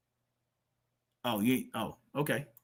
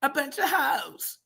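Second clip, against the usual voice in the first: high, slow mimicry, softer; mocking the inflection; 8 semitones higher, 5 dB quieter, slower than usual. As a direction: stretch it out in a high, put-on lilt. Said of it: "what are these inflections?".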